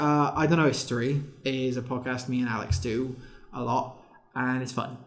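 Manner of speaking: broad Swansea valleys accent. Shown as a demonstration. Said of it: "Should I do the, the Swansea voice?".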